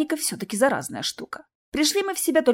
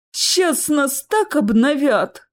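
slow, loud, higher stunned croak; 5 semitones higher, 5 dB louder, much slower than usual. A narrator's reading. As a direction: croak it out slowly, dumbfounded. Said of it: "ошалело прохрипел Чес". (ошалелый хрип)

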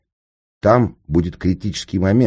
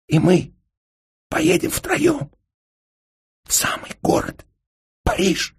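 awed babble. A narrator's reading. Senka cannot believe his luck, babbling faintly in awe. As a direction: stammer it out in tiny disbelieving gasps. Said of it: "не веря своему счастью, Сенька пролепетал".

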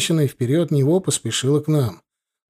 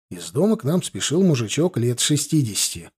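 plain reading voice

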